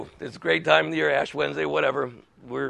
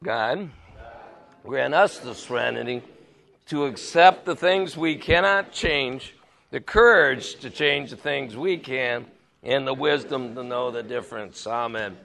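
group prayer; 5 semitones lower, 3 dB quieter than usual. Real, not acted. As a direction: lead the room through the prayer. Leads Serenity Prayer.

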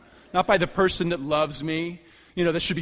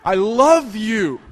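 very slow and louder, begging outright; pathetic desperate plea; 6 semitones higher, 7 dB louder, much slower than usual. Retold or imitated. Begging her again as she shoves him out, louder desperate plea. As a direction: raise the voice and stretch every word, desperate. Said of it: "just pathetic".

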